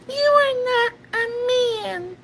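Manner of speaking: squeaky, very high whiny voice; tiny falsetto cartoon squeal; mock baby squeak, far above normal pitch; best Goku. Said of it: "you got the best Goku".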